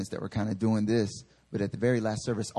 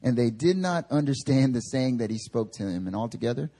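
higher, measured reading voice; scripture reading; 6 semitones higher than usual. Acted. Preacher reading Luke aloud to the church, measured reading. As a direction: read the passage to the congregation.

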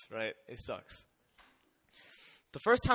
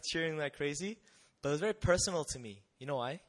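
ordinary delivery

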